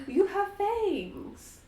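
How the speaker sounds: put-on high, very slow child voice; a small boy stating the obvious; little Strahd. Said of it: "And little Strahd says".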